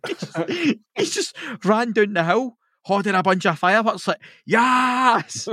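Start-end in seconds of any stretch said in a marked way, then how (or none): 4.4-5.5 high, loud mock battle cry; hammed-up triumphant yelp; gleeful war cry. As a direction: yell it like a madman charging downhill. Guy charges with fireworks.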